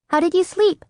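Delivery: put-on high bright child voice; cheerful little girl. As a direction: ask like an eager young girl. Child voice of Gabby.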